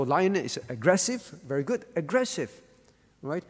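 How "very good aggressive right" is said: usual speaking voice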